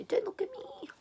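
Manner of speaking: very high, soft, shrinking plea; tiny high-pitched mock cower; timid squeak. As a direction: squeak it like you want to disappear. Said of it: "I just go".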